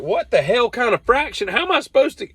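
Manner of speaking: high, incredulous outburst; exasperated. Retold or imitated. Fuming over a tape measure fraction, high incredulous squawk. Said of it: "And I'm like".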